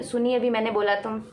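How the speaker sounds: ordinary talk, nothing marked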